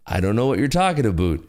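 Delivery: badly faked Canadian reply; bad Canadian accent. Streamer answering as the other Canadian, bad Canadian accent. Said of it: "that's the best I could do".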